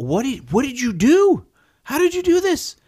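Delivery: very high, loud incredulous cry; disbelieving shout. Retold or imitated. Husband at the car door demanding what happened, disbelieving shout. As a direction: voice shoots up in shock.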